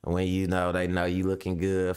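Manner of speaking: ordinary talk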